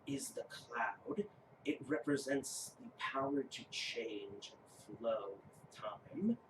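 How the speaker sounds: low, soft, slow giant voice; measured, reverent rune keeper; solemn giant. Acted. Giant explains stone rune to player, solemn.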